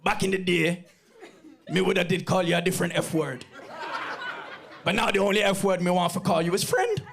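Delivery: light Jamaican patois uncle voice; a hint of a Jamaican uncle, warm. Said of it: "My uncle said this".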